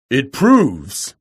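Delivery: loud roar bursting out; sudden angry roar. A narrator's reading. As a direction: explode with anger. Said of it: "he roared with a sudden anger".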